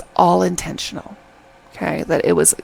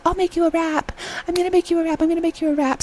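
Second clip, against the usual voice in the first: high, insistent mock doting; high-voiced nagging offer on repeat; pushy girl imitation; 10 semitones higher, faster than usual. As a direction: imitate an overeager admirer, pitched way up. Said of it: "and she's like".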